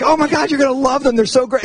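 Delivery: hammed-up excited praise; gushing. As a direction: sell the friend with mock enthusiasm. Acted gushing matchmaker quote.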